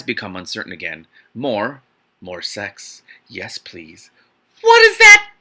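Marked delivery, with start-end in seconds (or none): 4.6-5.3 very high, loud shriek of disbelief; shocked squeal. Real, not acted.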